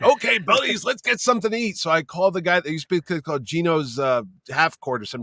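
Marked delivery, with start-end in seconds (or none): 0.0-1.8 put-on high, upbeat older guest's voice; rally the buddies brightly in a raised voice; chipper night owl. Tireless guest rallies friends at half two, chipper high voice.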